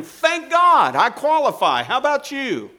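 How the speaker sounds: high, loud joyful cry; triumphant shout. Real, not acted. Preacher rejoicing before the congregation, loud triumphant cry.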